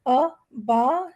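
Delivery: ا با drawn out slowly as a long baa; long alif demo. Shown as a demonstration. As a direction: stretch ا با into a long slow aa. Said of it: "جیسے یہاں پر انہوں نے دیا ہے".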